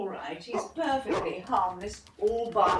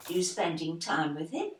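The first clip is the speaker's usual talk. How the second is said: normal talking voice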